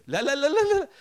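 high, loud frantic no's; panicked refusal. Retold or imitated. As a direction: cry out in mock terror. Frightened people refuse.

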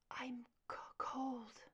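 weak, breathless whisper; barely able to speak, whispering; faint whisper. Acted. Wounded partner barely speaking.

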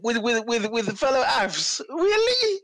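high squeaky disbelief, slow; incredulous disbelief. Real, not acted. Mocking John Terry's trip, incredulous. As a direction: stretch it out in pure disbelief.

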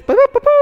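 high, loud imitated trumpet toots; trumpet blasts. Preacher mimicking repeated trumpet calls, high loud toots. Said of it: "y cinco veces".

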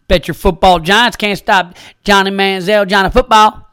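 cocky Texan trash talk; Texan accent. Pretend. Mock Texan telling off a New Yorker, hammed-up Texan accent. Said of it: "you think I'm mocking you".

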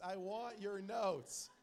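loud, drawn-out mock demand; shouted demand. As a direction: boom it out slowly, stretching every word. Same demand escalated, drawn out.

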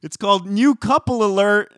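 high, loud segment announcement; announcer voice. Acted. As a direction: announce it like a show title.

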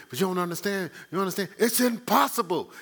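a panicked friend insisting it's hopeless; frantic person. Retold or imitated. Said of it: "they're frantic and they say".

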